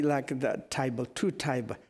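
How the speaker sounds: ordinary delivery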